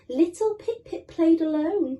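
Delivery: usual speaking voice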